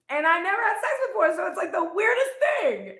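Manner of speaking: high, loud and fast, laughing through it; mock giggly confession. Mary confessing the pregnancy to Joseph, giggling high and fast. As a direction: blurt it out giggling, embarrassed.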